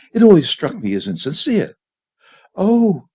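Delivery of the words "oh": light. A narrator's reading. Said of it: "I said lightly".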